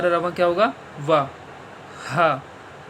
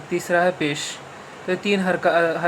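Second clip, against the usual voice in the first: usual speaking voice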